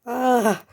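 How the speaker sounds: short, high "ah" cry; startled yelp. Real, not acted. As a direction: let out a loud startled yelp. Startled while playing. (cri de surprise)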